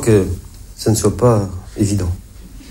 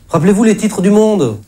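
insistent prodding; 9 semitones higher than usual. Acted. Comedian prods a blank audience.

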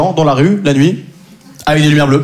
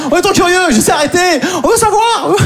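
high, much higher eager crowd voice; squeaky mock audience; 9 semitones higher than usual. Comic mimics the curious audience begging for the story. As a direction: whine like a nosy crowd. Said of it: "mimics the audience".

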